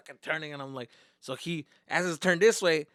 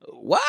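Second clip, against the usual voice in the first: big high burst of laughter; loud laugh; 14 dB louder than usual. Real, not acted. Laughing loud at his own story punchline. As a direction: crack up at your own joke.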